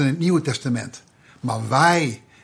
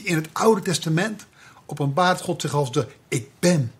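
normal talking voice

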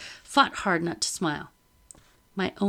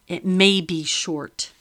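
veiled threat; 5 dB louder, slower than usual. A narrator's reading.

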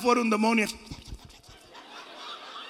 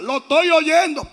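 louder, higher retold complaint; retold uncle calling out; 4 semitones higher, 7 dB louder than usual. Preacher imitates his migraine-stricken uncle to the congregation, mock calling out. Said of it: "Y él allá decía".

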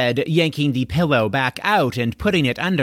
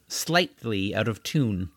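usual speaking voice

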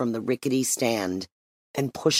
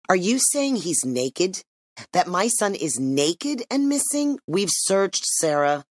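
horrified disbelief; 7 semitones higher than usual. Acted. Mother hears her son may be naked.

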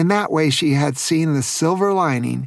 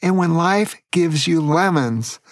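usual speaking voice

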